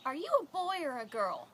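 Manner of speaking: loud, high imitation of a child; blunt three-year-old. Three-year-old turning back to the woman, loud high child voice. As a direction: call it out like an unshy kid. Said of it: "he turns back around to her and he says".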